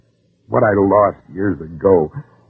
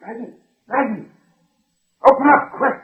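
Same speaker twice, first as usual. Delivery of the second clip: urgent plea; 14 semitones higher than usual. Acted. Fugitive urging at door.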